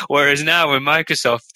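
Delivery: normal talking voice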